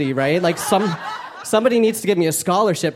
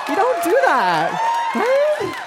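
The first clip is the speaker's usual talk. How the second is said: high mock scolding; slower than usual. Real, not acted. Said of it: "comic aside, high".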